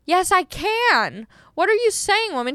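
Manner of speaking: high-pitched indignant comeback, retold; angry retort. Driver to lady yelling across the street, retold angry retort. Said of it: "it made me so angry".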